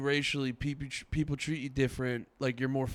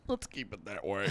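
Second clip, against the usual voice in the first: dry sarcastic quote; 7 semitones lower, 6 dB quieter than usual. Retold or imitated. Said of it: "and you're like".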